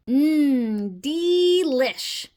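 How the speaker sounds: drawn-out, delighted yum; savouring relish. Acted. Frog tastes fly, savouring it.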